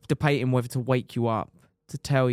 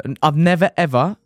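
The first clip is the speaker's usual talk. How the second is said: never and ever pushed higher and louder; emphatic never ever; 5 semitones higher, 9 dB louder than usual. Real, not acted. Rider recalling the worst pain of his life, stressing never, ever. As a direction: lean hard on never, ever.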